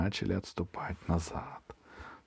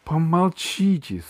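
slow, raised, annoyed command; irritated; 7 semitones higher, 3 dB louder, slower than usual. A narrator's reading. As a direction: silence them as a fed-up dragon. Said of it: "сказал дракон раздражённо". (раздражённый протяжный приказ дракона)

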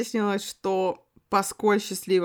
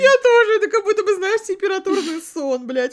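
very high, loud, giddy; shrieking in disbelief; hysterical; 13 semitones higher, 10 dB louder than usual. Real, not acted. Hysterical reaction.

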